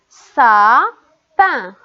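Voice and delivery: "sapin" slow and loud, stretched sa, then pin; split into syllables. Shown as a demonstration. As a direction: pause between the two syllables of "sapin". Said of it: "la première ou bien la deuxième syllabe". (« sapin » découpé lentement : sa… pin)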